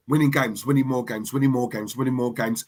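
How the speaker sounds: soft, low, repetitive monotone; low running murmur. Acted. Recapping a winning run to viewers, low murmured repetition. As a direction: tick them off quietly under the breath. Montage of season's wins passing.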